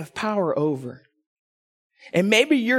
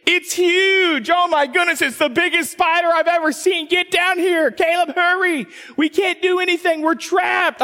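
high, loud mock-panicked shrieking; retold panicked scream; 10 semitones higher, 3 dB louder than usual. Wife screaming at husband about a huge spider, high and loud. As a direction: ham up a wife terrified of a spider. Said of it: "she starts screaming".

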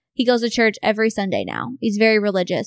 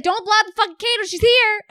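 excited warning; 10 semitones higher than usual. Retold or imitated. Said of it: "I was like".

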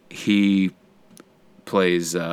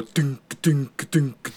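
higher mock thunking crossbow noises; crossbow firing noise; 6 semitones higher, much slower than usual. Describing the knights' crossbows, higher mock thunking sounds. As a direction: imitate a machine-gun crossbow going off. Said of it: "and go".

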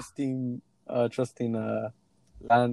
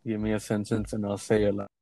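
lower, softer mimicry of a co-host; deep-voiced imitation; 4 semitones lower, 5 dB quieter than usual. Shown as a demonstration. Man impersonates his co-host to show off, deep-voiced imitation. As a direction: drop the pitch to mock her voice. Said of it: "My voice is not that deep".

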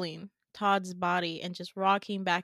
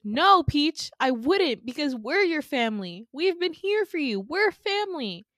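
high, loud emotional plea; acted wounded insistence, pitched up; hurt protest; 7 semitones higher, 6 dB louder than usual. Winter telling Peach they are family, hurt protest. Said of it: "And Winter goes".

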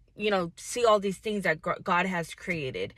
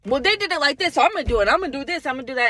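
a hint of her eager younger self, higher and louder; past self copying others; 6 semitones higher, 4 dB louder, much faster than usual. Vlogger imitating herself copying others, lightly eager. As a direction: rattle off the plan like a hopeful beginner.

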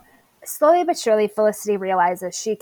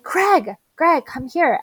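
calling out; 7 semitones higher, slower than usual. Retold or imitated. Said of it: "she's like".

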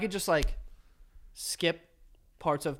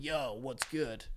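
slow mock come-on; 4 semitones lower, 9 dB quieter, slower than usual. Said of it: "five girls were like".

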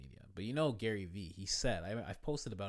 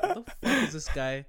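loud, high, laughing outburst; laughing indignation; 7 semitones higher, 7 dB louder than usual. Retold or imitated. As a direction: retell your outrage through a laugh. Retells own irritated reaction, laughing.

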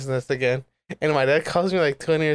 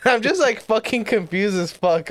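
laughing; 6 semitones higher than usual. Real, not acted. Laughing while retelling.

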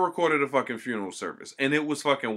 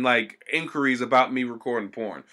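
ordinary talk

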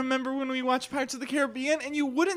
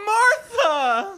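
loud laughing protest; exasperated shout; 7 semitones higher, 7 dB louder than usual. Acted. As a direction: shout her name, half laughing. Scolding his wife.

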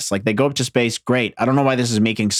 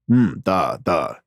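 brief, bad Russian accent; mock-Russian musing on "da"; fake Russian accent; 5 semitones lower, much slower than usual. Yuri answering his boss, fake Russian accent. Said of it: "That's a terrible fake Russian accent".

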